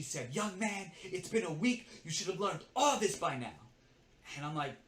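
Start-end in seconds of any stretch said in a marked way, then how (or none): 0.3-3.6 higher, louder mock telling-off; scolding. Coworker scolding the new assistant after a week, reprimanding. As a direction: scold like an elder, pitched up.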